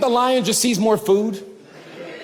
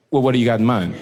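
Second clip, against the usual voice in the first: low, lightly voiced curious friend; intrigued friend; 10 semitones lower, faster than usual. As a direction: play it low and casually interested.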